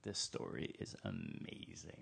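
religious leaders relishing the story, low and soft; smug leaders. Retold or imitated. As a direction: purr it out, pleased with yourself. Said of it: "the religious leaders are like".

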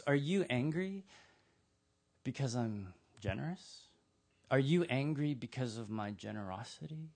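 soft, probing question; gentle challenge. Acted. Landowner questions the angry workers, gently challenging. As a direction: ask it quietly, letting the pauses hang.

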